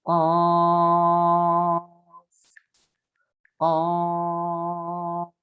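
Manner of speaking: qaf held out for six beats in a sung tone; long qaf. Shown as a demonstration. Teacher showing students the six-beat qaf, sung long. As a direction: draw qaf out long and melodic. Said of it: "Enam harokat".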